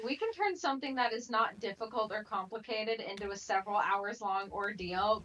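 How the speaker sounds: ordinary talk, nothing marked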